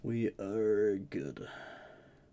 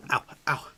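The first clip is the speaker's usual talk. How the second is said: sharp cold yelps; pained yelps; 6 semitones higher, 7 dB louder than usual. Real, not acted. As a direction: yelp from the icy water. Foot in ice bath.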